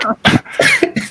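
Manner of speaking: high breathless wheeze of a laugh. Real, not acted. Laughing at Spencer calling him useless, breathless high wheeze.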